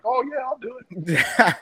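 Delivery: high, drawn-out eager agreement; eager kid. Retold or imitated. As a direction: imitate a kid jumping at the favor. Kid eagerly agrees to watch car.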